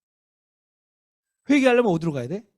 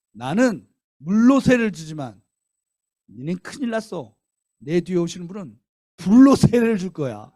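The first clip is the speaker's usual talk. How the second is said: proclaiming; 2 semitones higher, 8 dB louder than usual. Retold or imitated. John's proclamation.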